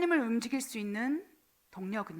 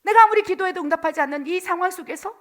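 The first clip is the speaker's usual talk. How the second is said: whiny grumbling, pitched up and louder; complaining; 5 semitones higher, 7 dB louder than usual. Acted. Israelites grumbling to God in the wilderness, whiny complaint. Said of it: "불평하고 불만하는 거예요".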